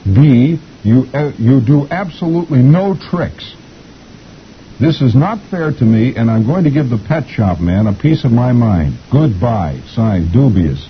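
slow, emphatic, rising in pitch; indignant parakeet. A narrator's reading. Parakeet's farewell note to Arthur, indignant and deliberate. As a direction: read out the grievances with mock indignation. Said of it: "This is the way a parakeet writes".

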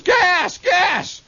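high-pitched urgent yell of warning; hollered alarm. Acted. Clerk hollering a gas warning as I left, acted high-pitched holler. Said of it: "he, he hollers".

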